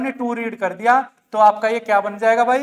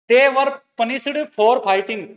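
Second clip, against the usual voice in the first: slow dictation of the sentence; 4 dB louder, much slower than usual. Shown as a demonstration. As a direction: spell each word out. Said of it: "dictating example sentence".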